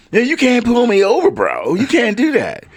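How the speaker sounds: high-pitched surfer-dude bro voice. Retold or imitated. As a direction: play a laid-back bro, pitched high. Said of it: "everybody's calling everybody bro".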